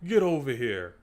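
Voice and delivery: weaker, quick throwaway catchphrase; mocking lame delivery. YouTuber mimics the disappointing actor, mock lame delivery. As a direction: say it without real menace. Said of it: "the dude's just like".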